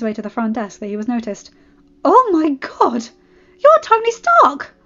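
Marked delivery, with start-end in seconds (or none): 2.0-4.7 high, loud, drawn-out amazement; starstruck gasp. A narrator's reading. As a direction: exclaim in awe, as if a celebrity just walked in. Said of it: "The receptionist gaped".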